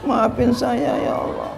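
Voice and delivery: high, soft, tearful plea fading to a whisper; tearful prayer. Retold or imitated. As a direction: beg God's forgiveness, near tears. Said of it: "nangisin dosa". (doa sambil menangis lirih)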